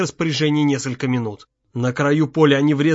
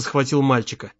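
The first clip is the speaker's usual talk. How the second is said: plain reading voice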